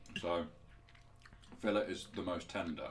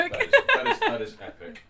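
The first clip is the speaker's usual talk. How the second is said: excited; 17 dB louder than usual. Real, not acted. Gushing over the steak.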